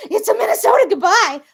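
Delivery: loud, high mock wail; exasperated outburst. Minnesotan losing patience while reassuring herself, exasperated outburst. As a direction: burst out in mock despair.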